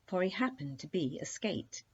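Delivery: plain reading voice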